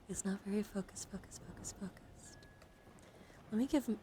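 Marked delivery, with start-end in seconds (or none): 1.1-2.4 much softer whispered repetition; hushed ASMR trigger word; whispered echo. Real, not acted. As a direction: repeat the word in a fading whisper.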